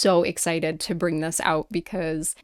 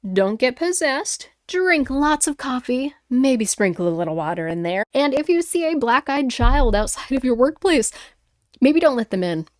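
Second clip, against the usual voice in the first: teasing, slowed sing-song advice; playful; 5 semitones higher, 4 dB louder than usual. Real, not acted. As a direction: tease the audience goodbye. Playful sign-off jokes.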